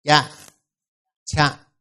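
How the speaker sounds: cha said twice, the second cha with a breath of air after the ch; teacher contrasts plain cha with breathy aspirated cha; aspirated versus plain cha. Shown as a demonstration. Teacher demonstrating cha versus aspirated cha. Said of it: "chữ sau cọ sát phát ra âm gió".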